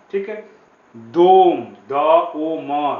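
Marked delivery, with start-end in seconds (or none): 1.0-1.6 दोम with the O hit loud; teaching stress, say दोम with a loud O. Shown as a demonstration. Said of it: "ओ पे stress है". 1.9-2.9 द ओ म, each sound slow and apart; teacher spelling दोम sound by sound, slowly; spelled out slowly. Shown as a demonstration. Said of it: "ओ पे stress है".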